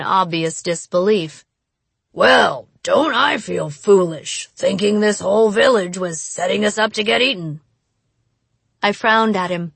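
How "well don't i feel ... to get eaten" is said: mock self-pity, sarcastic. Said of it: "sarcasm again".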